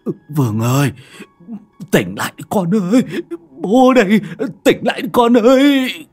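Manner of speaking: climbing into a high, loud crying plea; desperate pleading. Acted. Father begging his possessed son to come back, tearful cry. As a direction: beg your son to wake up.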